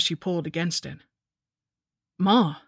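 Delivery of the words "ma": louder, imploring call to a mother; urgent, beseeching appeal; pleading. A narrator's reading. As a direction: call out as a plea. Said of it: "I pleaded".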